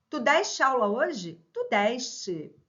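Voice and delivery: "deste" with an open E, "DÉS-te". Shown as a demonstration. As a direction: widen the E of "deste" into é. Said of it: "Pronúncias diferentes".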